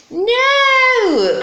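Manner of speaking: high, loud, drawn-out wail; witch's frightened cry. Acted. Witch flees the hungry dragon, high loud cry. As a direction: cry out in alarm. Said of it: "cried the witch".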